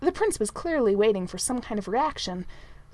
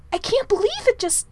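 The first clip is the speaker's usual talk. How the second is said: high, loud, breaking off mid-thought; excited amazement; 12 semitones higher, 6 dB louder than usual. A narrator's reading. Manservant marvelling at the toad until cuffed, excited. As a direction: blurt it in amazement. Merlin amazed, cut off by cuff.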